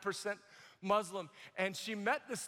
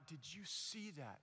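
very soft, lower retold question; acted hush, well under usual volume; 4 semitones lower, 14 dB quieter than usual. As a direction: ask it low in quiet awe. First hushed question to the crowd.

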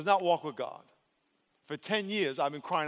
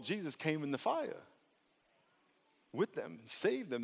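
normal talking voice

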